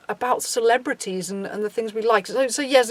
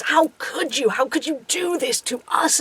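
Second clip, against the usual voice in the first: imitated aggrieved lament; mock betrayal; 3 semitones higher, 4 dB quieter than usual. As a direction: plead as if personally betrayed, in parody. Mimics betrayed fans.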